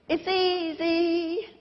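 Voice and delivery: slow, high Liverpool drawl on "easy"; Liverpool accent. Retold or imitated. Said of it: "That's my best Liverpool impression".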